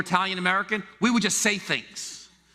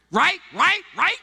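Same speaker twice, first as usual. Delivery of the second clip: much higher, louder eager "right"; pleading for agreement; 12 semitones higher, 6 dB louder, slower than usual. Retold or imitated. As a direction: fish for agreement, pitch up. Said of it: "expecting you to agree".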